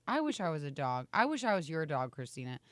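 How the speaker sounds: usual speaking voice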